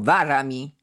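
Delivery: a short, sudden outburst; sharp exclamation. A narrator's reading. Nobleman warding the hired men off, sharp outburst. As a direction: snap it out impulsively, a warning. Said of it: "szlachcic porywczo zawołał".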